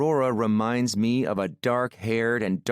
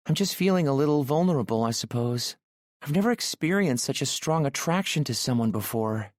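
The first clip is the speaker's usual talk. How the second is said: put-on higher, bright-eyed nervous young woman; voiced shy woman; 6 semitones higher, faster than usual. A narrator's reading. Aurora agrees to go up and shower, nervously higher. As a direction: lift the pitch for shy Aurora.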